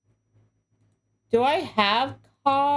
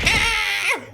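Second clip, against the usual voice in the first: startled shriek; 8 semitones higher than usual. Real, not acted. Sprayed again.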